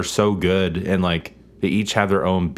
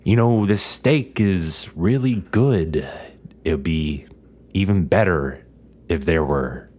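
slow, low, halting menace; low, measured, drawn-out lines broken by pauses; Jack Nicholson; 4 semitones lower, slower than usual. Shown as a demonstration. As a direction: imitate a deliberate cadence with long pauses. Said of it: "kind of cadence that he does".

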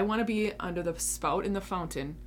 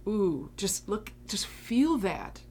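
gentle savouring; 2 semitones higher, slower than usual. Real, not acted. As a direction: linger on each word, drinking it in. Savouring compassion, mercy, grace.

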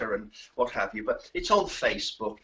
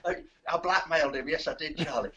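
ordinary delivery

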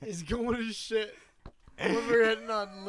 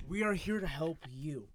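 reassuring helper; 2 semitones lower, 7 dB quieter than usual. Acted. Improv calm reassuring role.

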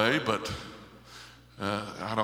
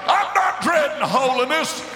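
preaching at full force; 13 semitones higher, 5 dB louder than usual. Real, not acted. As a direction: holler it out in full joy. Preacher at the climax, shouting.

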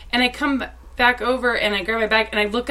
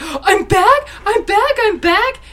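much higher and louder, gleeful repeated cry; imitating Jason Isaacs's excitement; 11 semitones higher, 4 dB louder, slower than usual. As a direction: crow it like the best news ever. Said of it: "And he was like".

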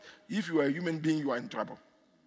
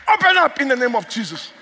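raised, insistent preacher's command; forceful command; 8 semitones higher, 8 dB louder than usual. Real, not acted. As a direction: order the doors open with more force. Repeats the command with more force.

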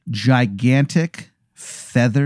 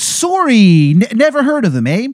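high, hammed-up Canadian accent; Angel of Canada, Canadian accent; 9 semitones higher than usual. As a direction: lay on a terrible Canadian accent, pitched way up. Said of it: "it is a horrible Canadian accent".

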